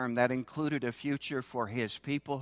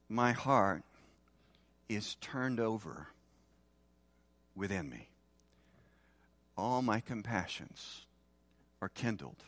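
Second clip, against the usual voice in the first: tender scripture reading; 4 semitones lower, 5 dB quieter than usual. Acted. Preacher reading God's words to his people, softly and tenderly. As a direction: let God's heart soften the voice.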